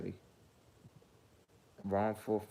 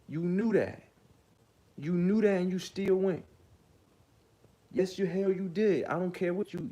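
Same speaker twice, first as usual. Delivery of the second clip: heated scolding; 6 semitones higher than usual. Real, not acted. Scolding the accusers directly.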